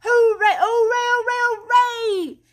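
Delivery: high, loud shout of glee; joyful cry. A narrator's reading. Rat cheers at his find in the snow. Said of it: "and then cried".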